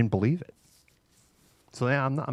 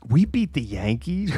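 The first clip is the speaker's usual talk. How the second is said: ordinary talk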